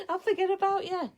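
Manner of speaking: a real laugh about forgetting the viewers. Real, not acted. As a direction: giggle it out at your own forgetfulness. Laughs at herself.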